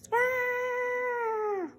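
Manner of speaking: high startled ah; a sudden fright, high up; high-pitched cry of alarm; startled yelp. Acted. Character startled.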